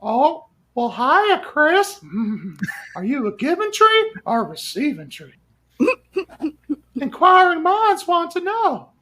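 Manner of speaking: sleazy creep voice. Acted. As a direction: leer at Chris like a creep. Sleazy voice after toking.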